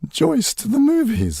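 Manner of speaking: bright hammed-up radio-play juvenile calling at the door; juvenile radio lead. Actor recalls radio plays, playing a young leading man, high and eager. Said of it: "they needed sort of juvenile leads to go".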